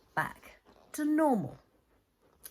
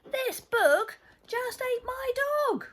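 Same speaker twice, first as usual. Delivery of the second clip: much higher, indignant outburst; alarmed Bella; 12 semitones higher, 4 dB louder than usual. Acted. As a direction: wail it in outraged disbelief.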